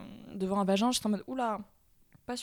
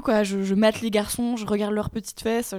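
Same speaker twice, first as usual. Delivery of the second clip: ordinary talk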